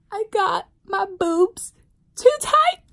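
high, loud, slow wail; panicked wail. Retold or imitated. Bride wailing to her sister about the tight dress, high and loud.

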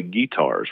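"guitars" as GHEE-tars, first syllable strongest; Southern initial stress. Shown as a demonstration. Linguist joking on Southern habit, first syllable of guitars stressed. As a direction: punch a long GHEE in "guitars". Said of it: "we put a lot of stress".

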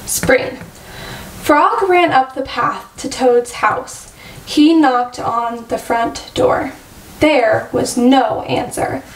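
usual speaking voice

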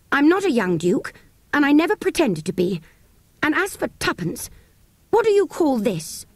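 put-on a boy bristling with anger; boy angrily standing up to the dealer; angry boy. A narrator's reading. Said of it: "he was very angry".

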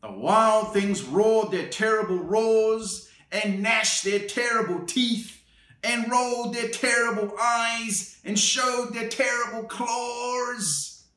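lively picture-book read-aloud; storybook narration for a little one; reading to children. A narrator's reading. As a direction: read it like bedtime story time.

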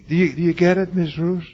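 loud, high, pressing question; urgent challenge. Real, not acted. Preacher loudly challenging Miss Roos whether she gets it. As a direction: demand that she truly grasp it.

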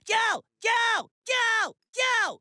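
high, repeated screamed calls; screaming a name. Acted. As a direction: scream the name to prove you can. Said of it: "I can scream your name real loud".

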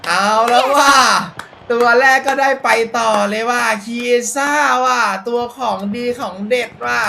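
high, loud thrilled shouting; real joy yelling about the card; excited yelling. Real, not acted. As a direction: celebrate the pull at full voice. Good player pulled.

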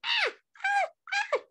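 short high-pitched play screams; a string of little shrieks. A narrator's reading. Clown circling trick-or-treaters to scare them, screaming. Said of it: "I screamed".

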